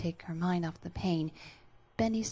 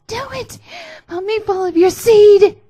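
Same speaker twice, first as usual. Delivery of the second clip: very high, very loud urgent cry; 20 dB louder than usual. Acted. Alice urging Bendy on in a very high, loud cry. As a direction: urge him on, high and loud.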